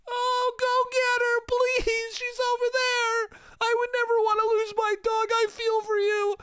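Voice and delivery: melodramatic, slow falsetto pleading; cinematic mock bystander. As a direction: gush with hammed-up movie sympathy. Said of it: "It became cinematic".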